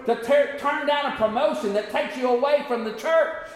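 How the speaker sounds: high-pitched, building sermon cadence; forceful preaching. Real, not acted. Preacher urging people to seek God first, preaching forcefully. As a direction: build the sermon with rising intensity, voice lifted high.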